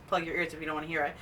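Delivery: ordinary delivery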